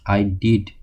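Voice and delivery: usual speaking voice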